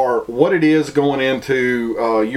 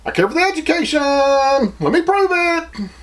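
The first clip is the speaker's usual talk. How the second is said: mocking virtue signaler; 12 semitones higher than usual. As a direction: parody a show-off demanding everyone hear him. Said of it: "those people that's over like".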